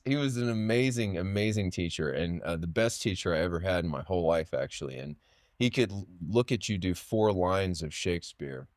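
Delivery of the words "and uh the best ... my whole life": normal talking voice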